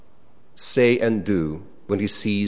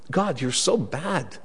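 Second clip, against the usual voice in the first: sulky accusation; 3 semitones higher, 5 dB quieter, slower than usual. Pretend. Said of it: "as like".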